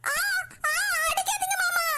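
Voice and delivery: high frightened cry of pain, begging him to stop. Acted. Girl being hit cries out to her uncle, high and pained. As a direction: yelp and plead not to be hit.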